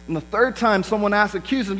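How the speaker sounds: normal talking voice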